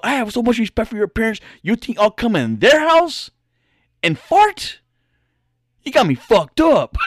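high, loud imitation of a furious older man; older guy ranting about respect, in high mock fury; mock angry rant. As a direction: ham up his rising outrage, peaking on fart. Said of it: "he's getting loked up, getting kinda crazy".

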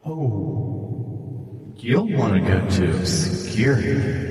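slow, hesitant otherworldly voice; eerie voice, about to leave; mysterious unseen stranger. Acted. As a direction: offer help as an eerie stranger. Game master voices the unseen stranger.

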